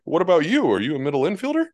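high, sugary imitation of an old donor; high mock donor voice. Mocking donors' questions.

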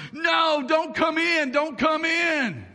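high shouted warning; yelling. Retold or imitated. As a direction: yell at him to stay out. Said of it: "they're yelling".

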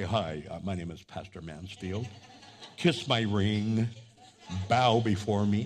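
low, self-important mock introduction; hammed-up grand clergyman; pompous pastor. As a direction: intone it low, as if expecting homage.

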